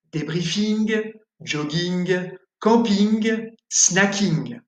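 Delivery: "Débriefing", "jogging", "camping", "snacking" ending in a hard "ing-g"; French nasal ING with a final G. Shown as a demonstration. Teacher demonstrating the French ING ending. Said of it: "vont se prononcer ing".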